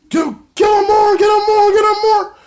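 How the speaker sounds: very high, loud, repeated shrieks; mock shrieking demand. Manager Laurel shrieking at her monster to keep beating the jobber. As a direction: egg him on in a shrill shriek. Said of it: "Laurel's just like".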